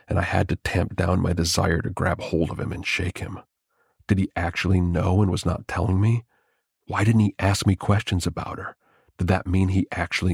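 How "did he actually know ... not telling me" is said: plain reading voice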